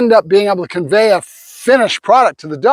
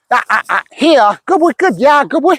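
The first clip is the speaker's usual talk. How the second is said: praising the dog; 5 semitones higher than usual. Real, not acted.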